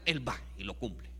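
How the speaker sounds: normal talking voice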